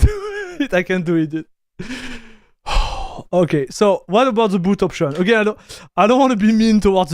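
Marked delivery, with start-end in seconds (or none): 0.0-0.7 a relapse of high laughter mid-sentence; laughing. Real, not acted. Streamer still recovering, laughing again. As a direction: laugh breaks through again. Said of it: "I'm just crying right now".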